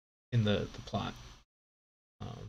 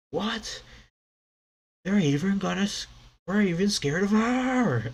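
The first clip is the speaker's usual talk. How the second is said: high, loud frightened cry; high panicked yelp; terrified crewman; 10 semitones higher, 5 dB louder than usual. Acted. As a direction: yelp it in shock and fear. Crew terrified of monster moms.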